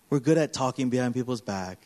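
ordinary delivery

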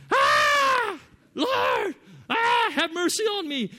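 high, loud crying shout for mercy; Loke's crying prayer. Retold or imitated. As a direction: wail to the Lord in desperation. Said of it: "when he prays, he's really loud".

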